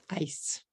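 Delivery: "Ice" as one clean syllable; one-syllable English. Shown as a demonstration. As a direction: say "Ice" in one beat, ending on a bare s. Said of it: "One syllable".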